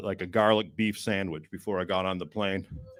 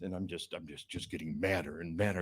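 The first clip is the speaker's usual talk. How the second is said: ordinary delivery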